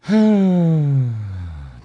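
long heavy exasperated sigh; weary sigh. Real, not acted. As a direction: let out a long tired sigh. Exasperated at decade talk.

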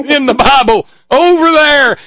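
loud, high hammed-up pulpit cry; mock preacher. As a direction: belt it out like a mocked preacher. Mimicking the preacher's clip.

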